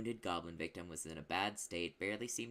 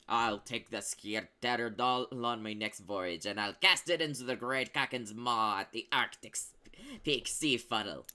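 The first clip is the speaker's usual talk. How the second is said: sea captain; 3 semitones higher, 7 dB louder than usual. Acted. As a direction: boom it like a ship's captain. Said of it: "said the sea captain".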